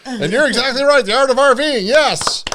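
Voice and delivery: high, loud, thrilled cheer; excited. Real, not acted. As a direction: celebrate the right answer. A viewer finally guesses right.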